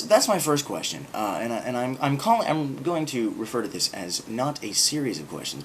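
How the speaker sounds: ordinary talk, nothing marked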